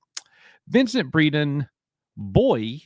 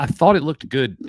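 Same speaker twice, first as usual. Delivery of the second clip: usual speaking voice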